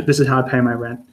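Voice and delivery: ordinary talk, nothing marked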